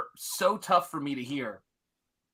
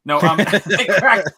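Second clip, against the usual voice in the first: louder, laughing through the words; laughing restart; 3 semitones higher, 10 dB louder than usual. Real, not acted. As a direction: retake it with a real laugh.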